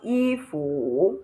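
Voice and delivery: "ifu" with fu dipping then rising; wrong third tone. Shown as a demonstration. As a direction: slowly dip and lift a long fu. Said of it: "there is no tone on the U".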